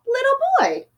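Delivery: put-on high, surprised call of little boy; exclaiming. A narrator's reading. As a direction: exclaim it with delight. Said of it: "Amu exclaimed".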